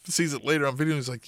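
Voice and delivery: ordinary talk, nothing marked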